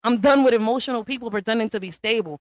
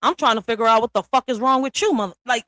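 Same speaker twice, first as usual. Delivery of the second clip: normal talking voice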